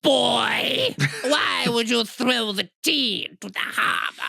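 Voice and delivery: high, slow, shouting inquisitor; mock interrogator. Questioning a Boston boy about the tea, slow high mock. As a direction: demand an answer like a pompous officer.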